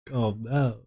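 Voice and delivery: softly imitating a viewer; mock soothing viewer. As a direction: play a viewer quietly talking someone down. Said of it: "You're like".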